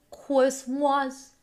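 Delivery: "Christmas" said as "kwis-mahs", high and loud; playful "kwismas". Shown as a demonstration. Streamer teases TikTok viewers, "Christmas" as "kwis-mahs". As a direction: call out "Christmas" with w for r. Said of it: "You don't know Christmas".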